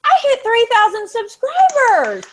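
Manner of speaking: high, loud, elated announcement; triumphant shout. Real, not acted. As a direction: shout your big milestone to everyone. Announces own milestone with glee.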